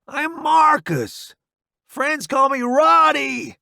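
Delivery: high, slow, loud call; shouted introduction. A narrator's reading. Roddy introducing himself to the newbie, shouted. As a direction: yell it over the whistling bombs.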